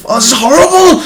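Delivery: high, strangled, half-laughing dread; strained mock distress. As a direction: push it out as if you can't get the words out. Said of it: "it's like".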